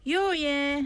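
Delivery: high called-out Busan "Yo, yeah"; Busan dialect. Shown as a demonstration. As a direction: call "Yo, yeah" to a waiter, Busan style. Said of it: "trying to get somebody's attention".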